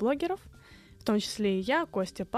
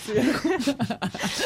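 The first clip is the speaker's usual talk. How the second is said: laughing; 3 semitones higher, 4 dB louder than usual. Real, not acted. Guest laughs at joke.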